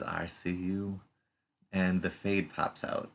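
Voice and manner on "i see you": creepy voice. Retold or imitated. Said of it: "in a very creepy voice".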